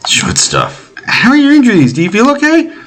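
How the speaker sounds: put-on high, anxious girl's voice; high-voiced girl relieved he woke up; worried young girl. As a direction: fuss over the injured man. Voices Tita at bedside.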